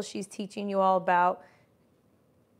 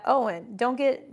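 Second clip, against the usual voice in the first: ordinary talk, nothing marked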